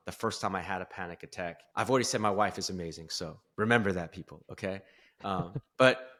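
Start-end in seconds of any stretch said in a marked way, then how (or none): none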